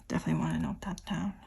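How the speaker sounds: tossing off a hushed aside to the camera; whispered aside. Real, not acted. Whispering a quick aside to viewers.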